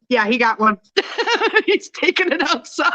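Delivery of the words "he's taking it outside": high, laughing through the words. Real, not acted. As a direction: laugh while telling it. Laughing at husband with mouse.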